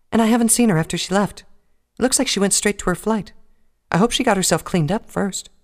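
high, gentle worried mare; timid pony voice. Acted. Fluttershy voiced by narrator.

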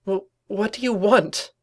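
high, slow wary question; nervous stammer. Acted. Twilight tempted and sweating, high nervous stammer.

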